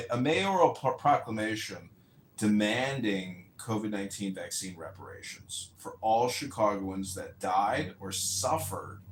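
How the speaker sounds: ordinary talk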